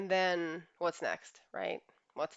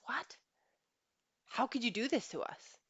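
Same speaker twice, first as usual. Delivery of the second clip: higher, stunned disbelief; shocked husband; 6 semitones higher than usual. Retold or imitated. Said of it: "And he says".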